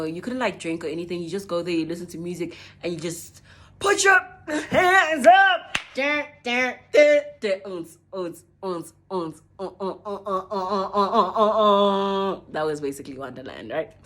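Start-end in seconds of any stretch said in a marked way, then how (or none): 2.8-12.4 mock festival beat. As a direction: bounce through a mimicked rave beat. Imitating festival music and dancing.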